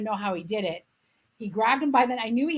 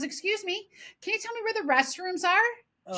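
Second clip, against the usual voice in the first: high-pitched imitation of a lady customer; polite customer; 10 semitones higher than usual. Customer politely asking the aunt.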